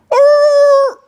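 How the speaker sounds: high, loud yelp of a dog in pain; imitowany skowyt psa. Retold or imitated. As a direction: yelp as if a leg just broke. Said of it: "it goes".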